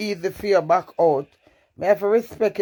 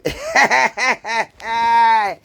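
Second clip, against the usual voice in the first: loud, high cackling laugh; 4 semitones higher, 4 dB louder than usual. Real, not acted. Streamer joking about getting nasty, loud cackle. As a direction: burst out in a long, loud cackle.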